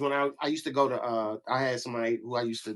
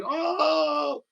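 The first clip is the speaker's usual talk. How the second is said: 1 surprised. Retold or imitated. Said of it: "They was like".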